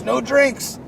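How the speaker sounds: high, loud mock-proud announcement; retold crackhead friend. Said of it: "he's just a fucking crackhead".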